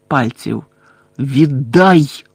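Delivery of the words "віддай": menacing growled demand; гарчання. A narrator's reading. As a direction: growl the order forcefully. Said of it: "прогарчав на вухо чийсь голос". (грізне гарчання, владний наказ)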